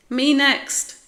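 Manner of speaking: high, loud volunteering shout; keen, high-pitched holler; eager cry. A narrator's reading. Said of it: "cried Spike again".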